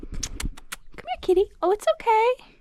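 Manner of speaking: high coaxing call to a cat; calling a kitty. Real, not acted. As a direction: coax the kitty, voice way up.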